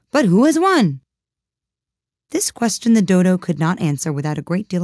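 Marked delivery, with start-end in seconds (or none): 0.1-1.0 eager calling. A narrator's reading. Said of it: "panting and asking".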